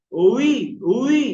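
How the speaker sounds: loud, clear w-glide on "উই"; rounded-lip wao. Shown as a demonstration. Teacher corrects the b habit, rounded "উই". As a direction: round both lips and push out "উই". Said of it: "দুটো ঠোঁট আপনার গোল করে".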